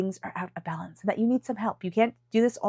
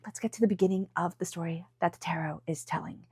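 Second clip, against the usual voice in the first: hushed secret whisper; 2 semitones lower, 7 dB quieter than usual. Acted. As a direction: lean in and whisper it. Sudden whisper into story.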